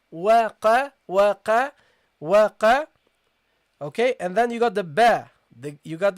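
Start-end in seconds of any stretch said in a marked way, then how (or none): 0.1-2.9 teacher calls out waqa for the class; qaf with fatha. Shown as a demonstration. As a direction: drill waqa loudly, qaf from the throat. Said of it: "And the qaf with the fatha".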